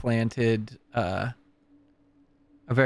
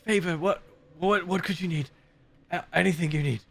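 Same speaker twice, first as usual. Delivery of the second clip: Dimitri voice; 6 semitones higher, faster than usual. Acted. Dimitri pleads with abbot.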